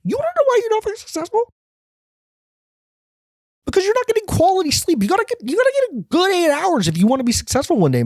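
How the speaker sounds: very high, fast squeaky hustle-guru voice; Gary Vee. Retold or imitated. Gary Vee lecturing a homeless guy in Starbucks, very high and squeaky. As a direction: lecture like a squeaky, overeager motivator. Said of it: "He goes".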